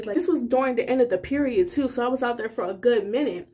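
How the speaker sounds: normal talking voice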